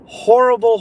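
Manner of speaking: Horrible thrown up high and loud in disgust; outraged cry. Real, not acted. Streamer to viewers about the verdict, outraged cry.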